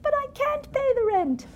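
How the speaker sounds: very high, wailing damsel in distress; helpless damsel. Pretend. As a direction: plead like a silent-film heroine. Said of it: "this childish parody of melodrama".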